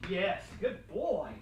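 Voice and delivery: slow, sing-song praise for the dog; drawn-out praise. Real, not acted. Trainer praising the dog, slow and upbeat. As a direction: stretch it out, rewarding the pup.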